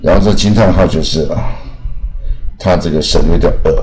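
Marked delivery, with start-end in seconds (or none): none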